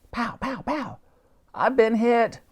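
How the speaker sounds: put-on high old Admiral cry; high hammed-up wounded cry; Admiral yelling. A narrator's reading. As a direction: yell that you're hit. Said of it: "yelled the Admiral".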